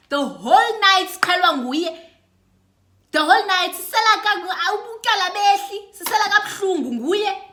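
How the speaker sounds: loud and high, full of outrage; loud, exasperated rant, breaking into a laugh; heated rant. Retold or imitated. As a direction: vent about the whole night. Ranting about the annoying girl.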